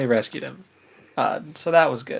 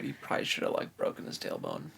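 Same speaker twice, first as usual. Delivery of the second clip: whispered aside; 12 dB quieter, faster than usual. Real, not acted. Quiet aside about injury.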